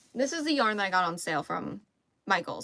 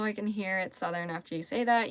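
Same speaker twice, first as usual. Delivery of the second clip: usual speaking voice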